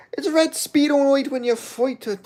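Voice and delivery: high Irish brogue taunt; loud Irish fighter trash talk; Conor McGregor impression. Streamer keeps taunting the chatter in a high Irish voice. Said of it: "I'm doing a fucking impression".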